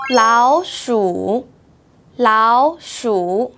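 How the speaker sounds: 老鼠 with 老 rising as second tone; slow, 老 up then 鼠 dipping; tone sandhi demo. Shown as a demonstration. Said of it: "the first third tone change to second tone".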